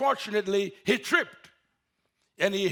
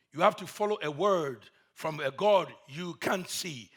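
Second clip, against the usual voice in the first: normal talking voice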